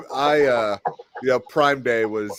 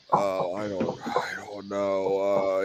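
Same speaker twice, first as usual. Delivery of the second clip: sleepy, croaky whisper; barely awake, mumbling in a whisper; groggy morning voice; 3 semitones lower, 6 dB quieter, slower than usual. Retold or imitated. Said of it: "you got that morning voice".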